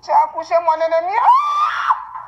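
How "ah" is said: high piercing grief cry; anguished wail. Real, not acted. Mother wails high over her lost daughter. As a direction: let out a raw cry of loss.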